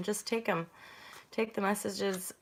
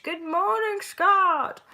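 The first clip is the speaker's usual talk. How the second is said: goofy greeting; 10 semitones higher, 7 dB louder, much slower than usual. Pretend. Sudden goofy greeting mid-reading.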